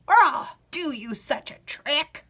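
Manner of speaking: put-on cracked old man's warning; old man threatening a trick. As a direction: warn him like a cranky wizard. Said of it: "cried the old man".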